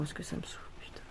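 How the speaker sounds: frustrated breathless whisper. Real, not acted. To herself about the lost canvas, a whispered gripe. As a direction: let it out under your breath.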